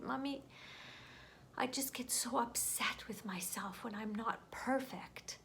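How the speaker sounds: put-on soft, whispered child's admission; hushed confession. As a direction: breathe it out like a small ashamed child. Daughter confesses to her mother.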